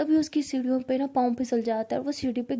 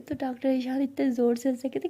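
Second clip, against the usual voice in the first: normal talking voice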